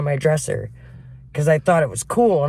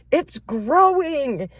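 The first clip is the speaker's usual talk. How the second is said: horrified stepmom; 9 semitones higher, much slower than usual. Retold or imitated. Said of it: "she goes".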